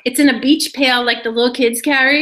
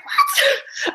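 mock indignation. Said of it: "I got mad".

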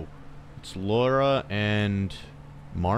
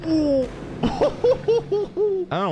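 high squeal sliding into laughter; nervous laugh; 5 dB louder, much slower than usual. Real, not acted. Player spotting a body, squealing into nervous laughter.

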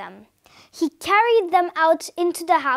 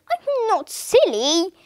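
slow, higher, mildly hurt protest; offended; 4 semitones higher, slower than usual. A narrator's reading. Husband answering his wife's reproach, slow offended protest. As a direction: draw it out, a little affronted. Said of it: "Twiddle looked offended".

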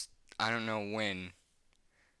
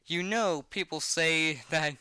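usual speaking voice

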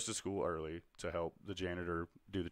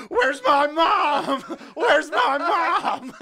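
very high, slow, drawn-out comic scream; hammed-up kid's desperate shriek; screaming for mom; 18 dB louder, much slower than usual. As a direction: wail for your mother at full volume. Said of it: "I'm screaming".

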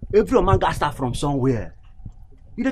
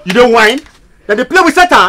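raised, hot challenge, pitch well up; angry shout; 7 semitones higher, 7 dB louder than usual. Acted. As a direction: snap back at him loudly. Heated quarrel over the charm.

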